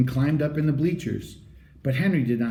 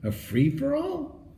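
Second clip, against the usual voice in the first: puzzled question; 12 semitones higher, 4 dB quieter, slower than usual. A narrator's reading. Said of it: "asked Henry".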